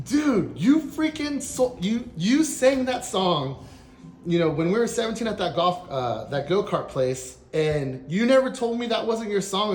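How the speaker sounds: high, loud, indignant retold rant; yelling accusation. Phoning the friend who fooled him, yelling. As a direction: confront a friend at the top of your pitch. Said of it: "I'm just yelling like".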